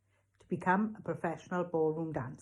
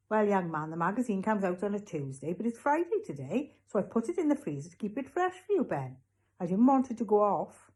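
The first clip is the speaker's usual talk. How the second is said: lilting voice climbing higher as it goes; explaining shopkeeper; 4 semitones higher, 4 dB louder than usual. Acted. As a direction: justify yourself earnestly to a young customer. Raj explains himself.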